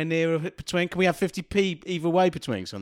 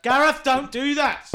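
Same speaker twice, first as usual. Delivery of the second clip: higher, louder plea to stop; alarmed plea; 8 semitones higher, 5 dB louder, slower than usual. Acted. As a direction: beg him to stop, half-laughing. Pleading with character to stop.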